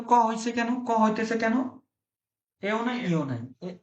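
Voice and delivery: ordinary delivery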